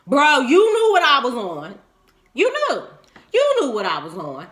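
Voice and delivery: a heated, rising accusation; exasperated scolding. Real, not acted. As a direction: call him out like he should have known better. Scolding the absent man.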